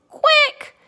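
very high, loud yelp; sharp acted cry; storybook shout. A narrator's reading. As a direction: shout it urgently as Chicken. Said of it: "And Chicken shouted".

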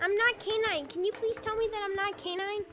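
high mock-innocent troll; K nine. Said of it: "be saying the entire time".

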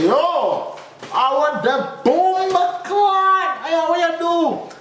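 very high, slow, strained yelp; hammed-up panicked plea to the nurse; mock panic. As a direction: squeal it out like it hurts. Retold panic during the swab.

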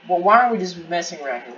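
usual speaking voice

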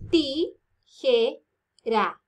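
"Tijera" very slowly as ti, je, ra; "Tijera" counted out in three separate syllables. Shown as a demonstration. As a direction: pause after each syllable of "Tijera".

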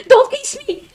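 panicked high shriek. Real, not acted. Yelling at game character.

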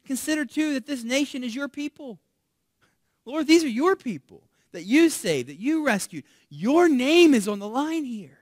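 loud, high urgent appeal; bold Moses. Retold or imitated. Moses reminding God these are his people, urgent loud appeal. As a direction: press hard, as if his name is on the line. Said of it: "And then he says in verse thirteen".

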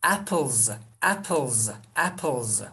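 "apples" as APP-ulz with buzzed end; voiced z ending. Shown as a demonstration. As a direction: draw "apples" out into a final z. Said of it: "yumuşak bir ünsüz geliyor".

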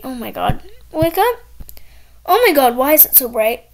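ordinary talk, nothing marked

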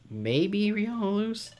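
stretched, high-pitched uncertain guess; high, drawn-out query. Acted. Sentry guessing at a footprint, high drawn-out query.

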